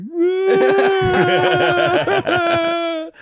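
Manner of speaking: long, loud, high-pitched mock bawl; mock crying wail. Drunk man told he's going to jail, hammed-up wail. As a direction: wail like an exaggerated crybaby. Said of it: "And then I cried".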